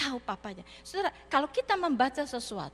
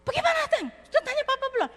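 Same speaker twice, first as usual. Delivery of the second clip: worried friend; 10 semitones higher, much faster than usual. Retold or imitated. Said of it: "Amin sudah worry".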